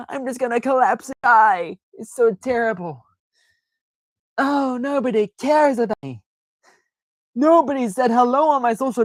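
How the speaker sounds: high, whiny theatrical wailing; drama queen. Pretend. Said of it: "the drama queen, dramatic".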